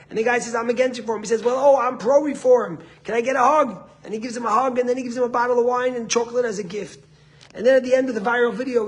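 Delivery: loud, high, excited retelling; animated preaching. Retold or imitated. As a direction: tell the anecdote with rousing energy. Retells video with rousing force.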